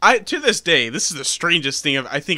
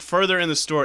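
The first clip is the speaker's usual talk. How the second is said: ordinary delivery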